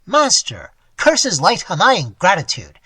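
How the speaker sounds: put-on old man cursing his ingrate ward. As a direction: hurl the curse. Old man curses the robber.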